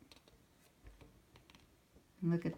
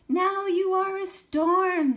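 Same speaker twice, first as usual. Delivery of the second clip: much higher, louder dramatic cry; teacher crying out; 14 semitones higher, 12 dB louder than usual. Acted. Teacher cries out to the dancing children, loud and high. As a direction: whip them up into a storm. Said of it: "the teacher cried".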